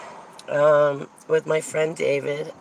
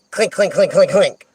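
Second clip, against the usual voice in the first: rapid, rhythmic metallic clinks; imitated clinking locks; 5 dB louder than usual. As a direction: mimic doors locking one after another. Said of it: "then all of a sudden I hear".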